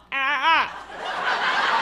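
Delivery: "Ah, ah" with the mouth left hanging open; open-mouthed mimicry. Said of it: "without closing your mouth".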